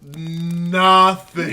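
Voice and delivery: slow, loud, drawn-out disgust; loud exaggerated complaint. Real, not acted. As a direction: hammer each word out in protest. Exaggerated complaint about the movie.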